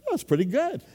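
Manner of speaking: high, pleased-with-myself voice; mock smug admiration of own work; hammed-up self-satisfied aside; smug self-praise. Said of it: "I go".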